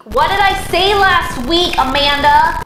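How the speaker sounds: loud, high-pitched telling-off; exasperated scolding. Acted. Host scolding Amanda over an overdone joke, loud exasperation. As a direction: raise your voice and demand an answer. Mock scolding, repeats question much louder.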